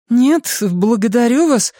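put-on small child voice, pitched far up; high child's voice. A narrator's reading. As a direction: answer like a child hurrying home, very high. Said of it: "произнёс высоким голосом ребёнка". (высокий голос ребёнка)

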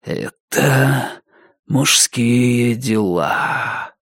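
slow, ghostly drawn-out voice; eerie, lingering murmur; whispering temple. A narrator's reading. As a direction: stretch it out like a disembodied whisper. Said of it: "а потом добавил одну фразу".

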